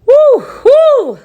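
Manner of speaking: high, loud woo to hold back tears; emotional woo. Real, not acted. Speaker fighting tears on a live stream, high loud woo. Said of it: "I get emotional".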